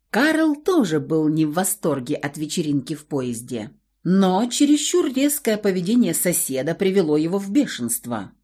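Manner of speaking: usual speaking voice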